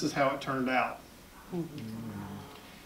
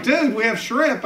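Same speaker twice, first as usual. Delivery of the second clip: higher, louder mock party host; pitched-up dude voice; imitated partygoer; 6 semitones higher, 5 dB louder than usual. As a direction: play an eager host offering food. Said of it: "And they're like".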